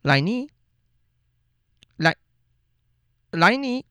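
calling a name into the silence, rising; calling Laini, hoping she answers. Pretend. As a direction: call her name like she's out of earshot. Calls out to absent listener.

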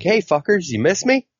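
put-on high, taunting hello to old enemies; cocky Negan greeting. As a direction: sing it out high like a gleeful villain. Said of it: "And he's like".

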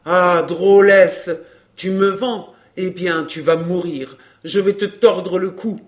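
loud, high-pitched rage; furious threat. A narrator's reading. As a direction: open with a long roar, then threaten murder. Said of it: "il se retourna furieux". (menace hurlée avec fureur)

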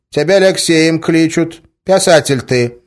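put-on rustic old man. A narrator's reading. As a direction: speak in folksy old-peasant dialect. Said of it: "Он так и говорил: «Ляксеем» и «писатель»".

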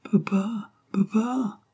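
put-on high, breathless little whisper of a baby; hushed baby whisper, high; twittering child's whisper. A narrator's reading. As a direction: whisper papa like a tiny child calling from above. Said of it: "cette voix gazouillante".

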